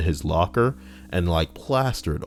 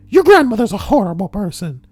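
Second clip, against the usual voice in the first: very high, loud sneering voice; hammed-up high snotty voice; snotty boyfriend; 13 semitones higher, 6 dB louder than usual. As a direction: declare it in a squeaky put-on voice. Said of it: "acted quarrel, boyfriend".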